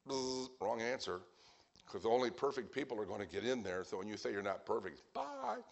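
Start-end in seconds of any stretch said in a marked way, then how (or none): 0.1-0.5 drawn-out buzzing noise; imitated buzzer. Preacher as God rejecting an answer, mock buzzer. 5.1-5.6 very high-pitched, sing-out goodbye; mock farewell. Preacher as God sending someone away, high mock farewell.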